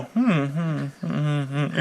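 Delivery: soft, slow mock hmms; imitated preening hums. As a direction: hum as if checking yourself out on screen. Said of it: "you keep on doing the whole".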